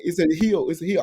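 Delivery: ordinary talk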